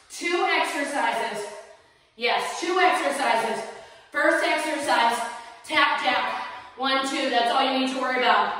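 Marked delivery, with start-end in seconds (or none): none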